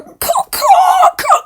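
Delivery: very high, loud cawing; piercing bird-of-prey caw; screeching vulture. Acted. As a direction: shriek the caws like a circling vulture.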